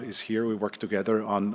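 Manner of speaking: usual speaking voice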